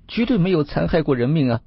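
high-pitched, earnest denial; high, pleading fox-spirit voice; fox spirit protesting. A narrator's reading. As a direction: swear innocence in a high, pleading voice. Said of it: "那位黄仙说道".

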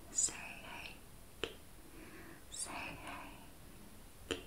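barely audible breath-only whisper; hushed ritual repetition, spaced out; whispered incantation. Real, not acted. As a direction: whisper each Reiki symbol name as a slow repeated mantra. Whispers symbol names while drawing them.

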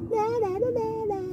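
nyah-nyah singsong; teasing sing-song. Acted. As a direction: tease the bear like a naughty child. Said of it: "He's sticking his tongue out".

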